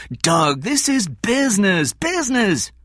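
high, loud, exasperated insistence; urgent, agitated argument; heated protest. A narrator's reading. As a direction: press the point hard, voice pitched right up.

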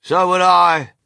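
high, loud agreement; indignant old grandma calling out; defiant cry. A narrator's reading. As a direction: chime in with a loud protest. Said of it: "cried Grandma Georgina".

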